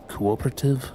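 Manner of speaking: ordinary talk, nothing marked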